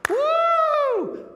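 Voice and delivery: shrill high whoop of delight; hammed-up cheer. King cheers.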